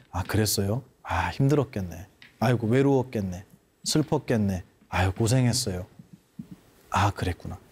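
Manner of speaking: 낮고 다정하게 공감하며; 힘든 사람 다독이듯이; 공감하는 말투. Shown as a demonstration. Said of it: "공감하는 말". (낮게 다정히 공감하는 말투)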